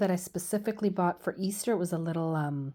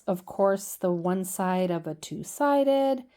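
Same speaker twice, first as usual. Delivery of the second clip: ordinary delivery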